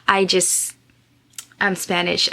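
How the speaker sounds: ordinary talk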